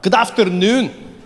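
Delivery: slow, hammed-up Scottish accent; bad Scottish accent. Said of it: "speak to people in their accent".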